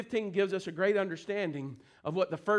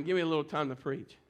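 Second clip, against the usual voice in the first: ordinary talk, nothing marked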